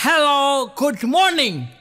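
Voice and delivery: high, loud cheery English hello; loud sing-song hello good morning; foreigner's greeting. Retold or imitated. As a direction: greet brightly like an American neighbour.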